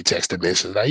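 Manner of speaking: ordinary talk, nothing marked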